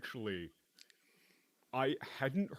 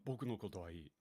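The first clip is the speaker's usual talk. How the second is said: trailing off; 5 semitones lower, 7 dB quieter, much faster than usual. Retold or imitated. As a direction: voice fading away softly. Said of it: "he trails off on".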